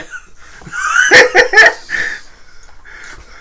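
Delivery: very high, loud squealing laugh; real shrieking cackle. Real, not acted. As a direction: scream with laughter. Howling at own joke.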